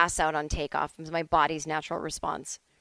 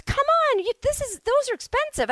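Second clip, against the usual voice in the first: very high-pitched mock flight attendant; insistent coaxing; 3 dB louder than usual. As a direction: press them in a squeaky-high, raised voice.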